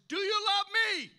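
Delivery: a loud divine voice calling across the garden; loud voice of God. Retold or imitated. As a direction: call it out like a voice from heaven. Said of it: "It was loud".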